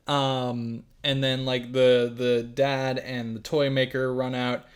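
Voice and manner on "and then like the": usual speaking voice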